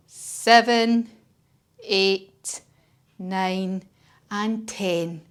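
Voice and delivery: soft, slow counting to ten; slow calming count. Shown as a demonstration. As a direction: count gently to calm down. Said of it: "we're gonna count to ten".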